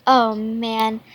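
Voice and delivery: usual speaking voice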